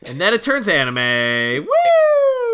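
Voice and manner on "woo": high, loud "woo"; whooping cheer. Pretend. Podcaster greeting the turn to anime, whooping. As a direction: let out a long high-pitched whoop.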